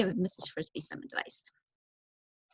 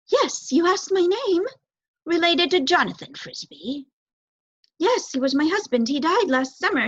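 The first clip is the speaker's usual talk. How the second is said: higher, louder, tense mouse mother; a startled little mouse, voice pitched up in fear; frightened mouse; 8 semitones higher, 4 dB louder than usual. Acted. Mouse mother answering the looming owl, frightened.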